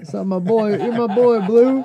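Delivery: high loud bro voice; hyped buddy shout. Retold or imitated. Mimicking a buddy greeting.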